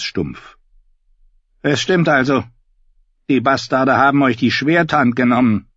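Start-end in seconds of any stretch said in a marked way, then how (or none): 1.6-5.7 high, loud bleating sheep voice. Acted. Davin voiced by narrator.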